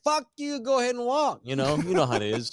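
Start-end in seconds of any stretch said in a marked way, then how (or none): 0.0-1.4 mock angry yell. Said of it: "He's like".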